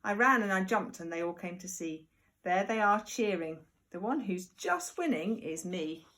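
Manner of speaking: usual speaking voice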